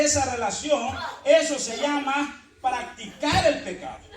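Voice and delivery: full-force preaching. Acted. Preacher exhorting the congregation, loud and slow. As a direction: preach it out hard to the church.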